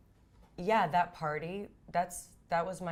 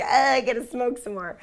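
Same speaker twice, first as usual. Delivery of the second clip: louder, higher, eager; excited; 6 semitones higher, 12 dB louder than usual. Retold or imitated. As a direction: blurt it out, all keyed up. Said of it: "I'm like".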